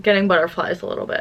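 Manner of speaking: normal talking voice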